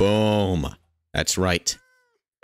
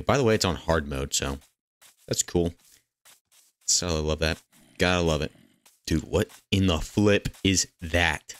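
ordinary talk, nothing marked

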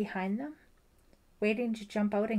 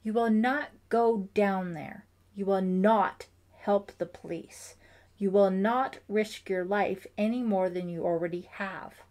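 louder, firm and sharp, laying down the law; sharp insistence; 8 dB louder than usual. A narrator's reading. Father forbidding Ellie to help police, sharp and loud. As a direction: forbid it, no room for argument. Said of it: "insisted sharply".

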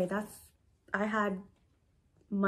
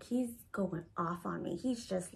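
ordinary delivery

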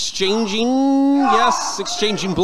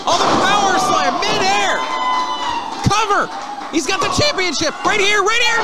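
excited shout; 11 semitones higher than usual. Real, not acted. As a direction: scream the near-win. Near-fall for the title.

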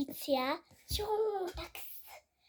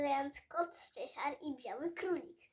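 usual speaking voice

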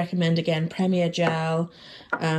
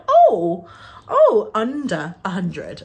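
pleasant surprise; 5 dB louder, slower than usual. Retold or imitated.

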